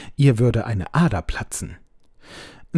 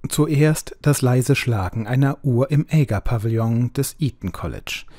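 usual speaking voice

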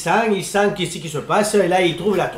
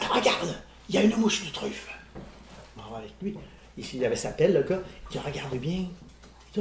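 much softer, lower, a hushed storyteller's voice; 4 semitones lower, 9 dB quieter than usual. Retold or imitated. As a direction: drop to a near whisper as if not to scare the fly. Hushed retelling of truffle hunt. (voix feutrée, presque chuchotée)

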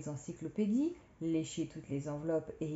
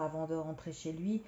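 ordinary delivery, nothing marked

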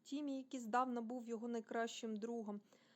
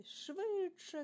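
high, thrilled call; excited cry; 9 semitones higher, 4 dB louder than usual. A narrator's reading. Alf urges the swing faster, crying out. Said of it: "кричав Альф".

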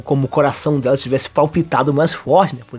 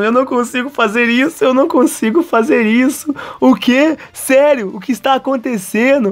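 high-pitched panicked disbelief; mock desperation; 7 semitones higher than usual. Kazuya despairing as they slide, high-pitched mock panic. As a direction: squeal it, hammed-up and desperate. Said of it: "continua ali desesperado".

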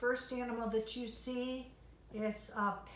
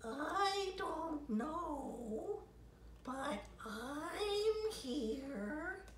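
very slow, higher dreamy octopus; 6 semitones higher, much slower than usual. Acted. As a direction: stretch the words out lazily like a sea creature. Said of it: "said the octopus".

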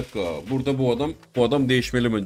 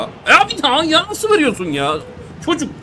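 furious yell; 6 dB louder than usual. Real, not acted. Gamer yelling at his own player for giving the ball away, furious. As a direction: scream at the player who lost the ball.

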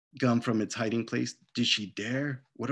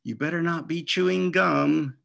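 scolding mom; 5 semitones higher, 4 dB louder than usual. Acted. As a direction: scold her like a fed-up mother. Mom warning daughter.

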